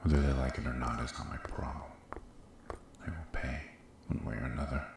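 hushed, brooding to himself; muttered aside. Acted. King alone, fuming over harm to an innocent, muttered aside.

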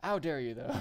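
laughing mock indignation; half-joking angry call-out; playful outrage. Real, not acted. Repeats outrage after calm remark.

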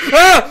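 loud, high burst of fright; startled scream. Real, not acted. Player cut off by a stalker, startled scream. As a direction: yelp as something jumps out.

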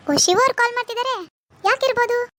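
high-pitched, curious surprise; surprised. Acted. Surprised by an unexpected incoming call. (ಆಶ್ಚರ್ಯ, ಕುತೂಹಲದ ಎತ್ತರದ ದನಿ)

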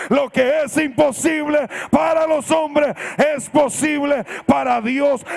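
high, very loud, slowed declaration; triumphant preacher's yell of a retold cry; shouting on. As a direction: shout the verse at full force, drawn out. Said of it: "el hombre siguió gritando".

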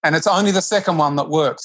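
ordinary delivery

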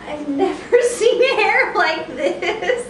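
high, loud laugh through words. Real, not acted. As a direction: laugh as you say it. Grandma laughing at baby's hair.

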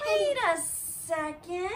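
plain reading voice

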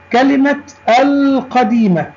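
القديمة with a long, clear l; high-pitched teacher demo sounding the l in القديمة; moon lam. Shown as a demonstration. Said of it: "لام قمرية". (نطق «القديمة» بلام ساكنة واضحة)

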